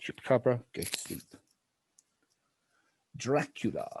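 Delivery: normal talking voice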